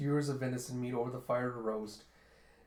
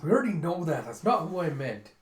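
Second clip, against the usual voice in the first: higher, louder, impatient correction; exasperated retort; 3 semitones higher, 6 dB louder than usual. Acted. Dragon correcting Zeno's misunderstanding, exasperated. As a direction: cut him off in exasperation.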